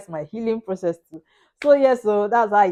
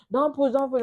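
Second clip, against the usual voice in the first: retold friend's shout; 6 semitones higher than usual. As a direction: imitate a friend calling out urgently. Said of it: "My friend was shouting".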